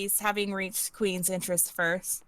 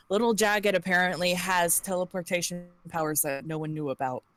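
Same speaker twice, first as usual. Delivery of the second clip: ordinary talk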